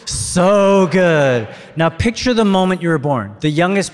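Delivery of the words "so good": big loud, high group shout; yelled from the gut. Real, not acted. Preacher leading the congregation's yelled response, loud. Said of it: "you guys yell out the choice".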